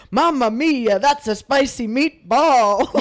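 put-on loud, stretched-out stage Italian accent; hammy Italian accent. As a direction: belt it like an old commercial's cartoon Italian. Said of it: "practice Italian".

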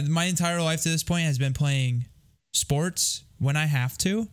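ordinary talk

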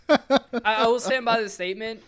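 ordinary talk, nothing marked

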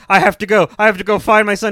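high, loud urgent rush; mock frantic daughter. Daughter rushes off to find her son, mock frantic. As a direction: blurt it out in a hammed-up panic. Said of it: "she's like".

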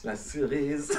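put-on cerise drawn out as se-rise in a home-country accent; accent slipping in. Friend teases him as his home accent returns on cerise. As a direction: let the old accent creep into cerise. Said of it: "Je t'ai dit que l'accent allait revenir".

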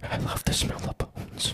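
slow, creepy whispered line. Pretend. Mocking the Smithsonian as a creepy bone hoarder, slow whisper. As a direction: whisper it like a sinister collector savoring bones. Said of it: "I could just hear the Smithsonian go".

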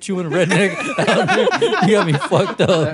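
high, loud, incredulous rant; comic indignation breaking into laughter; laughing mock outrage. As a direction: protest loudly while cracking up. Mock outrage at film plot, laughing.

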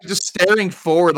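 ordinary talk

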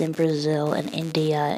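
ordinary talk

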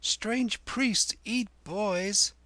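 put-on high, very slow little boy; high-pitched, drawn-out warning; frightened-child aside. A narrator's reading. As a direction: warn slowly in a high child's voice. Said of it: "whispered Chota Lal".

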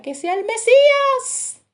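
grito agudo y lento de esperanza; clámalo lento y muy alto, como madre que da a luz. Retold or imitated. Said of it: "gritaba y decía". (madre de Judá que acaba de dar a luz: grito alto y lento)

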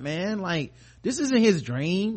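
ordinary delivery

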